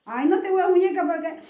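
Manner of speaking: ordinary delivery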